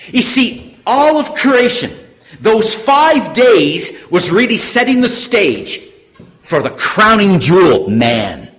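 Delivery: high, loud sermon delivery; impassioned preaching. Real, not acted. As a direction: drive the point home to the congregation. Preaches at raised force.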